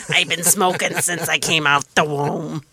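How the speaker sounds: lightly rasped smoker voice; a hint of a smoker's rasp; gravelly voice. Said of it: "with that gravelly voice".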